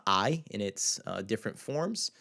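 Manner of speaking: ordinary talk, nothing marked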